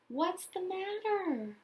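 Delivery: ordinary delivery, nothing marked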